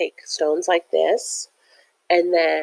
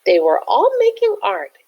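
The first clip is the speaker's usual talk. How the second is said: excited; 14 semitones higher, 4 dB louder than usual. A narrator's reading. Excited story reading.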